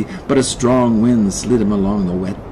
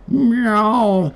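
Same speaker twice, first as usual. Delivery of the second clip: high, drawn-out cat wail; wailing meow; 11 semitones higher than usual. A narrator's reading. Cat looking back to the man, wailing. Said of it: "Henry wailed".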